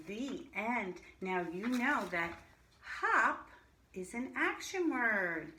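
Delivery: normal talking voice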